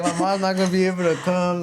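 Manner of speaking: laughing through "my mom's not gonna be able to come". Real, not acted. After his friend teases him about the distance, laughing as he repeats it.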